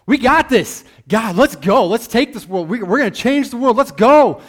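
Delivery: loud, high pep-rally cheer; hyped-up disciples. Retold or imitated. Preacher voicing the disciples before the crucifixion, hyped and loud. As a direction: shout it like a team before the big game. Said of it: "They're like".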